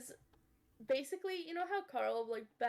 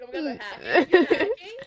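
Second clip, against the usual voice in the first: loud, high mock gamer panic through laughter; too-serious gamer; 6 semitones higher, 15 dB louder than usual. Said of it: "that's how people always talk when they".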